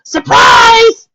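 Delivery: very high, loud party shout; screamed surprise. A narrator's reading. Ponies greet the newcomer at the door, screaming. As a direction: scream it out like a crowd springing a surprise. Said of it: "ponies screaming".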